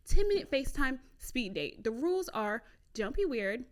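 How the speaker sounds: usual speaking voice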